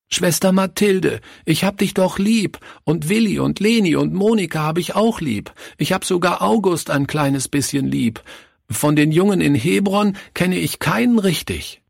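put-on high child's voice, begging; a small boy pleading to stay; boy begging the nun to let him stay; pleading little boy. Narrator voices the boy Günther.

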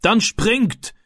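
high, urgent call to jump; called command. A narrator's reading. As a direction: order them from a distance. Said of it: "rief der Mann".

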